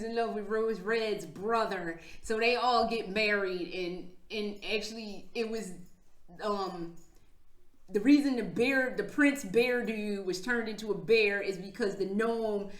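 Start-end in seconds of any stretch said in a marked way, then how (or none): none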